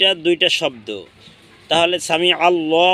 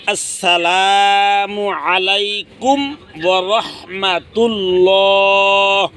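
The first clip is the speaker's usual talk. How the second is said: slow, higher, drawn-out salam chant; prayer salam; 3 semitones higher, much slower than usual. Shown as a demonstration. Teacher showing how to turn the head with the salam. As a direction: stretch the salam slowly like ending a prayer. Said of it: "সালাম ফিরাইতে হয় এভাবে".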